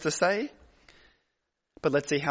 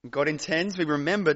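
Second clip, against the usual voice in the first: ordinary delivery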